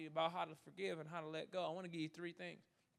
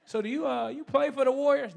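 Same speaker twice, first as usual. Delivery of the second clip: higher, louder mock fan question; curious fan; 8 semitones higher, 13 dB louder than usual. Airport stranger asks about the Warriors, imitated curiosity. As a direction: lightly imitate an inquisitive stranger. Fans asking if he plays.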